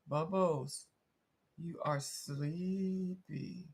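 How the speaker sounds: very slow, stretched-out pretend hypnosis; hammed-up hypnotist lulling her cat; mock hypnotist. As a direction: draw out sleepy like a stage hypnotist. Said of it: "that little A S M R voice".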